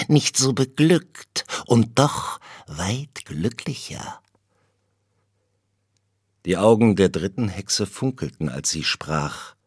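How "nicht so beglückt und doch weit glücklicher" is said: slow, breathless witch whisper; whispered prophecy. A narrator's reading. Second witch whispers her riddle to Benko, slow whisper. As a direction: whisper it like a secret omen.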